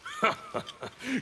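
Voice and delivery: sharp derisive laugh; mocking laugh. Havoc laughing scornfully at the constable. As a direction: laugh in her face.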